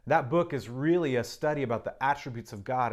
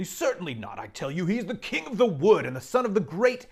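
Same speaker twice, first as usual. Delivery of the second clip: stern Mister Beaver; 5 semitones higher, 8 dB louder than usual. Acted. Mister Beaver sternly telling the children who Aslan is, loud and proud. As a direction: boom out the lion's greatness. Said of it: "said Mister Beaver sternly".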